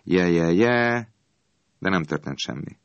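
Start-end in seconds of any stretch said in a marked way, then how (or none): 0.0-1.1 slow, stretched-out hawker's call, in an odd voice; drawn-out holler. A narrator's reading. Ice man calling to empty streets, a slow holler. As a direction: try the call once more, slowly. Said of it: "közben próbálkozott".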